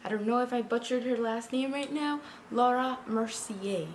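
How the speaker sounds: ordinary talk, nothing marked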